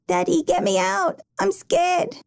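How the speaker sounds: put-on high, frightened little girl's voice; trapped girl pleading with her daddy; pleading child. A narrator's reading. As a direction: beg like a scared small child. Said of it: "Jamie Lee's pleading voice".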